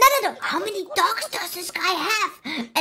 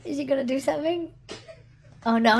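ordinary talk

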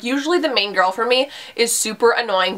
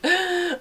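mock gasp; 8 semitones higher, 4 dB quieter than usual. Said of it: "I was just like".

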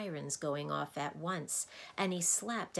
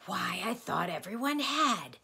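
higher, louder surprised exclamation; 5 semitones higher, 5 dB louder than usual. A narrator's reading. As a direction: sound taken aback. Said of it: "in a surprised tone".

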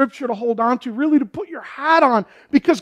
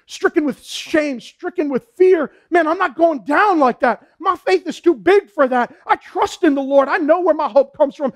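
raised, emphatic pulpit voice; impassioned preaching; 4 semitones higher than usual. Real, not acted. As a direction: preach it with fervor and drive. Impassioned preaching beside quieter talk.

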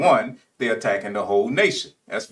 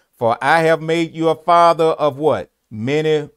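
preaching scripture; 6 semitones higher, 6 dB louder than usual. Acted. Preacher reads scripture with call-out.

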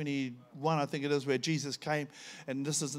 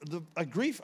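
normal talking voice

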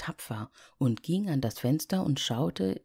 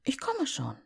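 put-on little girl; 10 semitones higher than usual. Liese will mitkommen.

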